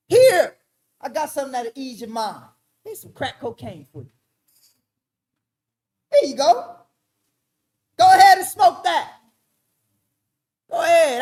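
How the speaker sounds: mock government voice. Mocking the government offering crack.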